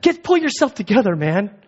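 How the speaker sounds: high, loud mock scolding; imitating his wife telling him off; wife snapping him out. Wife telling panicking husband to get a grip, mock scolding. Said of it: "mentally was like".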